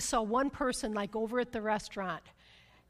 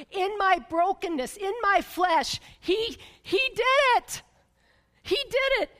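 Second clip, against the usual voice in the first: higher and louder, building to a triumphant cry; rising praise; 7 semitones higher, 5 dB louder than usual. Real, not acted. Preacher to congregation, building to triumphant praise. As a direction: lift it up, testify with joy.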